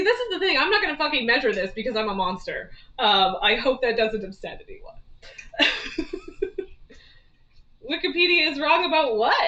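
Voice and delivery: ordinary talk, nothing marked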